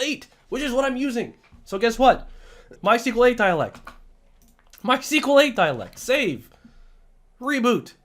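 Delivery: high, punchy, worked-up delivery; emphatic and fired up; hyped up. Real, not acted. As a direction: hammer out each step triumphantly. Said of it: "I'm getting hyped up here".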